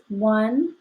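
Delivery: slow, deliberate counting. Shown as a demonstration. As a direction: count the sentences off slowly. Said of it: "If you look over here".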